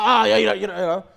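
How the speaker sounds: loud, exasperated mock fool snapping back; frustrated outburst. Foolish person irritated at the questioner, loud frustrated retort. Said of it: "They'll get frustrated".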